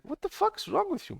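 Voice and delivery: high-pitched exasperated retelling; exasperated retort. Retold or imitated. Retelling his angry confrontation.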